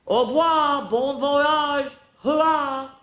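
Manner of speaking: high, bright French farewell cheer; cheering French fries. A narrator's reading. Fries sailing away cheer au revoir in high voices. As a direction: wave them off merrily in French.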